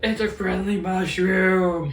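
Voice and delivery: high, drawn-out mock delirium; hammed-up character quote. Acting out Sokka's mushroom scene, hammed-up character quote. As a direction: stretch the words out in a goofy high voice.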